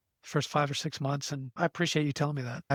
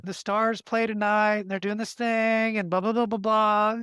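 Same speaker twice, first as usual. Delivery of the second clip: imitating a runner chatting to a baby, slow and raised; drawn-out, higher sing-song patter, imitated; mock baby-talk chatter, stretched out; 4 semitones higher, 4 dB louder than usual. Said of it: "he's sitting there talking to the baby".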